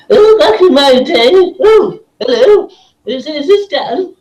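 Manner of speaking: high, quavering elder full of oohs; doddery old person. Acted. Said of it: "one favourite is".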